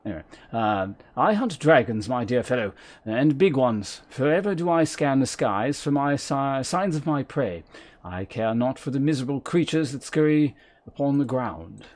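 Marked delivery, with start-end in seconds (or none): 1.2-11.8 lightly voiced proud dragon hunter; a hint of lofty hunter. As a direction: declaim it like a formal fantasy huntsman. Restarts reading outside the abandoned accent.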